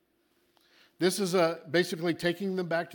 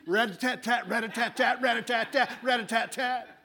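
higher, louder rapid-fire gun sound; machine gun noise; 5 semitones higher, 4 dB louder than usual. Retold or imitated. Mocking believers firing scriptures at God, rapid machine gun noise. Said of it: "quote them at God like a machine gun".

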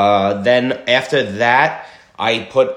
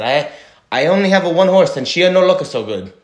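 broken-English Italian horse dealer, louder and higher; Italian accent; 8 semitones higher, 5 dB louder than usual. Retold or imitated. Rich guy's joke, Italian seller answers in Italian accent. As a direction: play the old Italian seller, sure of himself. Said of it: "I like your Italiano voice".

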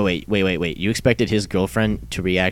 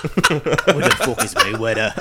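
laughing mock Conor line, slow; a giggly slow Irish quote; laughing while quoting; 3 semitones higher, slower than usual. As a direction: repeat the Conor line, cracking up. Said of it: "tiny little Conor McGregor".

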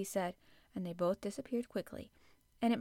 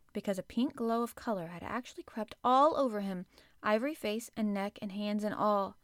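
ordinary delivery, nothing marked

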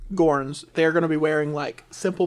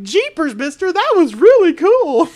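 put-on high, excited young boy; gushing eager sidekick kid; spunky kid voice; 13 semitones higher, 12 dB louder than usual. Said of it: "He's just like, the stereotypical, like, spunky".

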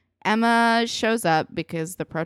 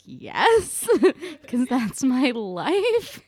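high, drawn-out retort broken by laughter; laughing mock exasperation; 7 semitones higher, 4 dB louder, much slower than usual. Boy answering a condescending classmate, laughingly mock-exasperated. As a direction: imitate an incredulous answer while cracking up.

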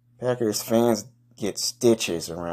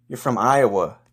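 ordinary talk, nothing marked

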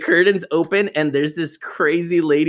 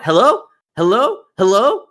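calling into the phone; 7 semitones higher, 4 dB louder than usual. Retold or imitated. Phone line goes dead.

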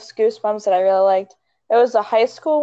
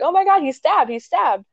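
high-pitched imitation of panicking girls; mock freaking out; 8 semitones higher than usual. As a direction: act out a panic, starting high and easing down. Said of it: "all the girls are starting freaking out".

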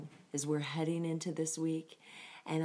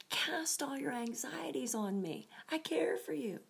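tender whisper; 8 semitones higher than usual. Pretend. God voiced speaking tenderly.

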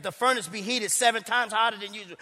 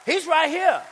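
emphatic proclaim; 7 semitones higher, 6 dB louder, slower than usual. Real, not acted. Preacher exclaiming, repeated line.

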